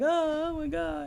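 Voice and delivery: high, slow, gasping "oh my God"; sudden gasp of realization. Retold or imitated. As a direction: gasp it out as it suddenly dawns on her. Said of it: "she's like".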